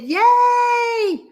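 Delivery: high, loud squeal of joy; delighted shouted yay; excited cheer. Real, not acted. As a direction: cheer her back in. Cheering a returning registrant.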